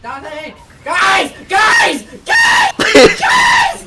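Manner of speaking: shouting for the team; calling out. Real, not acted. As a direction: yell across the room for help. Calling out to teammates.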